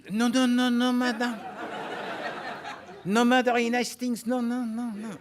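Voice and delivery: put-on Poirot reassures the old lady, French accent. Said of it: "I said".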